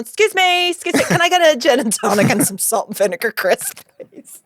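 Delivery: high, chirpy polite request; ordering gin from the waiter, mock-polite and high. Ordering drinks at sex show.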